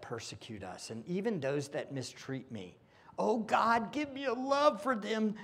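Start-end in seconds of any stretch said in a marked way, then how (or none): 3.2-5.3 high, earnest plea to God; fervent prayer. Real, not acted. Preacher praying aloud before the congregation. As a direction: pray it out with rising urgency.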